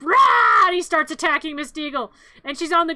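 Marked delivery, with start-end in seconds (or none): 0.0-0.7 loud high mock dog roar; loud growling attack cry. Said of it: "the dog's like".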